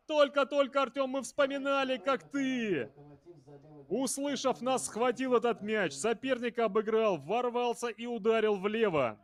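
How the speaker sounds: high, rapid excited recap; excited replay. Real, not acted. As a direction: ride the thrill of the goal. (взволнованный пересказ гола)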